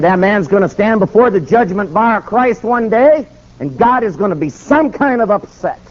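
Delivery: high-pitched preacher's pronouncement; righteous judgment. Retold or imitated. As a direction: pronounce judgment with full conviction.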